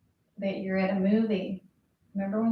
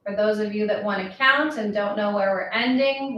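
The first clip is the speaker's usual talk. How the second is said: usual speaking voice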